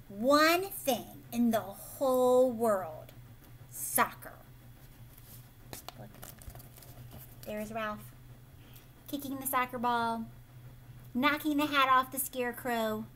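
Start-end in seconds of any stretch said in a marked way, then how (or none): none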